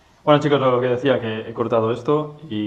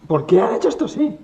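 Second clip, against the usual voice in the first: exasperated; 11 semitones higher, 5 dB louder, faster than usual. Real, not acted. Complaining about badly made part.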